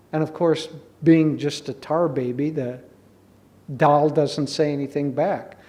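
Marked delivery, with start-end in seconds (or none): none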